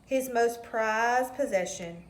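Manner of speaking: ordinary delivery, nothing marked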